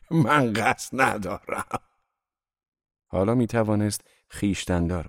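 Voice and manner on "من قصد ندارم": slow, drawn-out gloating retort; vengeful mockery. A narrator's reading. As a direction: stretch each word out scornfully. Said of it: "خندهٔ انتقام جویانه ای سر داد و گفت".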